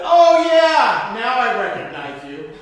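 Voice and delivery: high, loud mock neighbor voice. Preacher imitating the neighbor who finally recognizes him, high and loud. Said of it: "she said".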